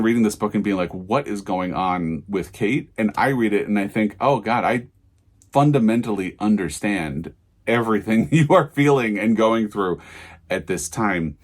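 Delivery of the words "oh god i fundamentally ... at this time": ordinary talk, nothing marked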